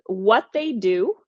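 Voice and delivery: ordinary talk, nothing marked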